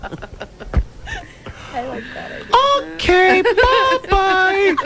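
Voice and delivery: high, slow, slurring tipsy caller; drunk voice. Shown as a demonstration. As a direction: drawl it out like a happy drunk on the phone. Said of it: "I think you ought to do it that way".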